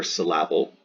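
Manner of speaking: "syllable" said sil-LAB-ul; deliberately off "syllable", middle syllable stressed; stress on second syllable. Shown as a demonstration. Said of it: "putting the stress on the able".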